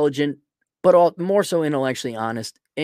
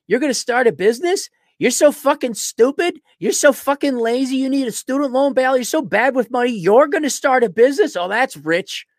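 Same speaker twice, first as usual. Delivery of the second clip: higher, louder sneering rant; mock scornful rant; 7 semitones higher, 3 dB louder than usual. Mocking feminists who took a student loan bailout, a high sneering rant. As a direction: sneer it at them like they're fools. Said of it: "I'm like".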